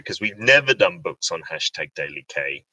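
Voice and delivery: ordinary delivery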